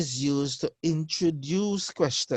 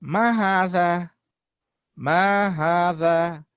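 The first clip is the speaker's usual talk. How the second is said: clear asking voice; 3 semitones higher, 8 dB louder than usual. Shown as a demonstration. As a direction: pose the question out loud. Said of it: "the question here is being asked".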